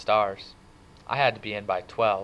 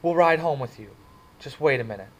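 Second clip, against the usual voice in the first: urgent plea; 5 semitones higher, 6 dB louder than usual. Acted. Cherry pleading to stop fight.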